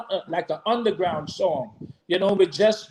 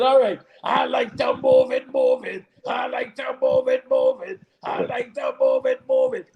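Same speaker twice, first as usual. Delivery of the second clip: Cookie Monster voice; 3 semitones higher than usual. Shown as a demonstration. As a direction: growl the hook like a hungry puppet monster. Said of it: "Sing it with your Cookie Monster voice".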